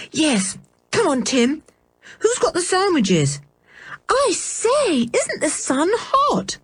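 put-on girl impatient to set off; eager young girl. A narrator's reading. Girl rallying the others, high-pitched. Girl's voice at the start of outing.

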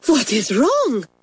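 slow, high French teacher's cry; alarmed cry. A narrator's reading. Mamselle to two staring girls, alarmed. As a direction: cry out in startled concern, drawn out. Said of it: "she cried".

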